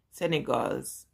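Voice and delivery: ordinary talk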